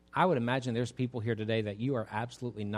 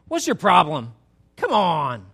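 mock scolding; 4 semitones higher, 11 dB louder than usual. Acted scolding of struggling believer.

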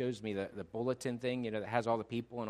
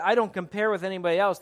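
insecure claim, raised and louder; higher-pitched, insecure insistence; 8 semitones higher, 10 dB louder than usual. Shown as a demonstration. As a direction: protest it a little too insistently. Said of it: "There's the insecure".

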